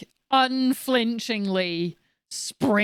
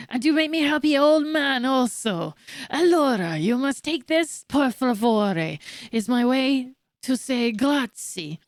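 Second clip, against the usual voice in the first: higher, hammed-up Italian accent; bad Italian accent; 3 semitones higher than usual. Happy old man thanking the finder, hammed-up Italian accent. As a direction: lay on a fake Italian, stretching the foreign words. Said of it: "Italian is like, I, I j-, it's just Spanish".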